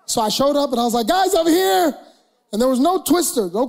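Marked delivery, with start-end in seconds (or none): 1.1-2.0 raised call across the room, pitch up; cheerful call across a party. Retold or imitated. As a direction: call out to the guys, eager. Calling friends with Twister.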